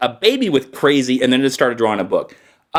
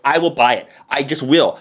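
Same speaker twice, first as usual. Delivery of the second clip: normal talking voice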